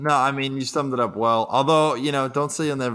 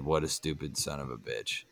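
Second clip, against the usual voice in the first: dropped much lower and much softer, a muttered aside; under his breath; 10 semitones lower, 14 dB quieter than usual. Retold or imitated. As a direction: let it slip out under your breath, low and hushed. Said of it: "under his breath".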